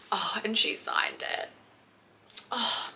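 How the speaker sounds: whispered in delighted surprise; excited whisper. Real, not acted. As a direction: hush it, thrilled. Surprise at signed copy.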